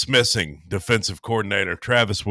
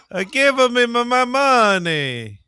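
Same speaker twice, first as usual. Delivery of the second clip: mock demand; 4 dB louder than usual. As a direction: stretch out the money demand for laughs. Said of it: "let me try again".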